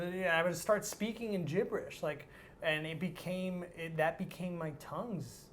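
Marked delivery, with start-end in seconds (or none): none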